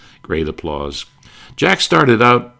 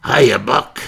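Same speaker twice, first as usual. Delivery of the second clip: a mock gravelly, higher greeting; gravelly Andy Devine; 7 semitones higher, 5 dB louder than usual. Sidekick greeting Jack in a mock gravelly voice. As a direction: croak a friendly hiya. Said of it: "in that gravelly voice".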